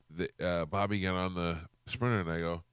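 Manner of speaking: ordinary talk, nothing marked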